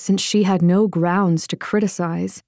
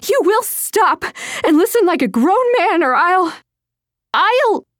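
very high, loud threat that runs out of words; enraged, sputtering; 12 semitones higher, 3 dB louder than usual. Acted. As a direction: rage until the threat breaks off. Enraged threat while chasing him.

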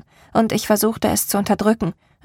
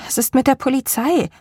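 desperate; 5 semitones higher than usual. A narrator's reading. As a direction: plead for an answer. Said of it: "fragte ich verzweifelt". (verzweifelt gefragt, höher)

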